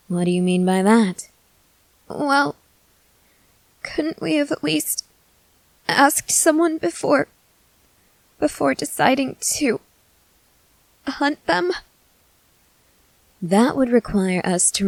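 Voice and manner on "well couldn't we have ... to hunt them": put-on higher, unsure young woman voice; hesitant young companion. Young companion hesitantly questioning the vampire, higher voice. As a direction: question him gently and higher pitched.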